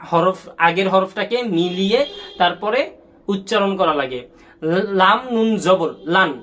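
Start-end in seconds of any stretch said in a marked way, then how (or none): 4.7-6.3 লাম নুন জবর named slowly, then joined as লান; spelling out. Shown as a demonstration. Teacher modelling for pupils, slow spelled-out recitation. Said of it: "তোমরা কিন্তু বানান করে পড়বা".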